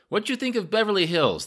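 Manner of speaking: high, loud question in broken English; loud foreign questioner. A narrator's reading. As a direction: proudly belt out your English. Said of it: "he spoke rather loudly".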